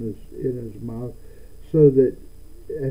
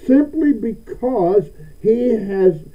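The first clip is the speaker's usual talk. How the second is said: preaching; 6 semitones higher, 7 dB louder than usual. Acted. Preaching at full force.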